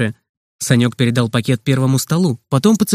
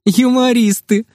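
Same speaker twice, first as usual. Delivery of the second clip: high-pitched amused teasing; mirthful nurse, pitched well up; amused, after laughing; 7 semitones higher than usual. A narrator's reading. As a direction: tease the children with mock pity. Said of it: "простонала она, смеясь".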